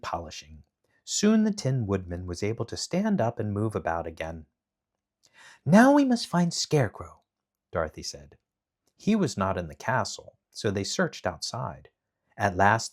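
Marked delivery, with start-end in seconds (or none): 5.6-7.2 put-on girl voice. A narrator's reading. Dorothy voiced in read story.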